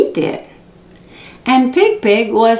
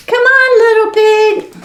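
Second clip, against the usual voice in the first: calling out; 11 semitones higher, 4 dB louder than usual. A narrator's reading. Siblings calling Little Pig.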